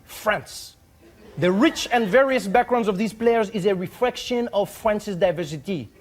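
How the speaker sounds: put-on emphatic, formal French accent; proud proclamation in a French accent. Ambassador defending the French team, proud French-accented formality. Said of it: "read it how I hope he wrote it".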